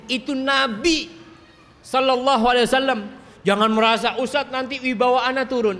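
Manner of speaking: high, loud sermon delivery; impassioned preaching. Real, not acted. Preacher telling husbands the Prophet did his own chores, impassioned preaching. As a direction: drive it home to the congregation, pitched high.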